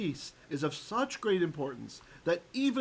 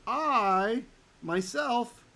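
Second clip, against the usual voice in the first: "I, myself" pitched up, with a loud "I"; strong emphasis; 6 semitones higher, 4 dB louder, much slower than usual. Shown as a demonstration. Teacher demonstrating emphasis, "I, myself" high and heavily stressed. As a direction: punch "I", then set "myself" apart, high. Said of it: "Meaning there's some emphasis here".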